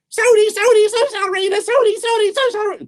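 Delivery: very high, rapid squirrel chant; squeaky high-speed singsong refrain; fast squirrel. Acted. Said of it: "Gotta say it real fast, like a squirrel".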